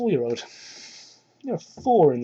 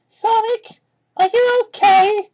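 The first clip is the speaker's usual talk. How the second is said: put-on much higher, childlike little boy voice; high kid voice, worried and kind; little fox kid voice; 14 semitones higher, 6 dB louder, slower than usual. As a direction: play a sweet young kid, eager to help. Young Tails asks gently.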